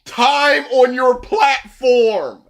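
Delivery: slow, drawn-out exasperation; exasperated plea. Real, not acted. Viewer scolding the show for its casting, slow and exasperated. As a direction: plead slowly, spacing out the words. Exasperated reaction to the video's voice acting.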